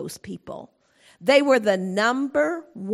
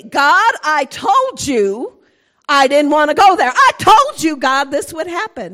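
angry Jonah; 3 semitones higher, 12 dB louder than usual. Acted. Jonah scolding God for mercy, loud indignation. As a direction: throw it at God like an outraged prophet.

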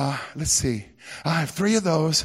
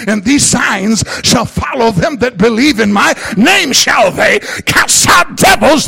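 louder, driving sermon delivery; full-force preaching; 5 semitones higher, 8 dB louder than usual. Real, not acted. Pastor to the congregation, full-force preaching. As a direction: preach it out to the whole house.

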